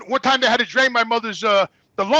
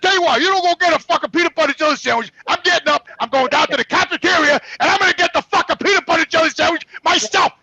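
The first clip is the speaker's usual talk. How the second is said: higher, heated, driving rant; angry defiance; 6 semitones higher, 3 dB louder than usual. Retold or imitated. Son vowing to fetch mother's sandwich himself, angry. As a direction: announce it in furious defiance. Acted quarrel with nurse over food.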